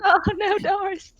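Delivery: squeaky, high cry of dismay; high-pitched dismay. Real, not acted. Player seeing Norris targeted, high-pitched dismay.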